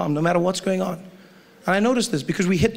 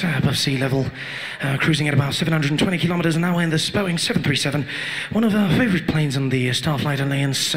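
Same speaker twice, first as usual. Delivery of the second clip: pilot announcement; 2 semitones lower than usual. Acted. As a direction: soothe the cabin like a pilot who has it all under control.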